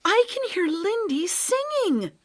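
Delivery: high, loud joyful outburst; excited. Acted. Boy hearing his lost friend singing, excited shout. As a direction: burst out with the discovery.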